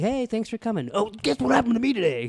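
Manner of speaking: imitating friend. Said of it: "He literally is like".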